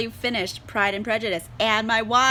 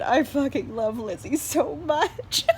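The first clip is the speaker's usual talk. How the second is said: slow, half-laughing, half-crying gush; laughing, near tears; 4 semitones higher, slower than usual. Real, not acted. Reviewer gushes over her favourite heroine, laughing tearfully. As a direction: overcome with fondness, laughing through it. Gushing about favourite character.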